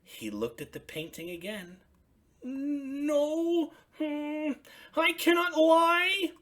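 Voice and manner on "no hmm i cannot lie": high, bright puppet voice for Ernie; excited high Ernie calling out. Acted. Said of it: "he said".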